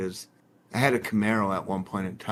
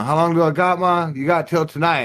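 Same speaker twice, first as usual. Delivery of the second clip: raised, higher voice for the back-and-forth; mock-argue with mom, loud; louder quoted exchange with mom; retold exchange with mom; 5 semitones higher, 4 dB louder than usual.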